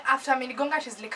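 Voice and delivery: plain reading voice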